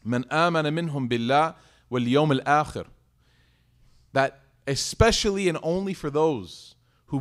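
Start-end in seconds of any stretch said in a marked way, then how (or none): 0.0-2.9 fast melodic Arabic recitation of a verse; Quran recitation. Real, not acted.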